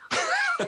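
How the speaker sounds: a high, sudden laugh at the punchline; short, high, amused laugh; burst of laughter. Real, not acted. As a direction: laugh at the irony. Punchline: the kid still left.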